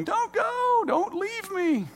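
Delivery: put-on very high child's voice, pleading; high, tiny toddler plea with the last words slowed; wailing toddler. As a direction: play a small child begging a parent not to go.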